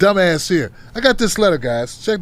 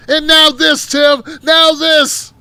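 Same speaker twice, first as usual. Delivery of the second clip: higher, louder, dragging out "now"; outraged shout; 7 semitones higher, 4 dB louder, much slower than usual. Retold or imitated. Quoting an outraged emailer.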